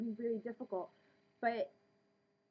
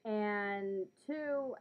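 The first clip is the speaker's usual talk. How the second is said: normal talking voice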